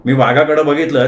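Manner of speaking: plain reading voice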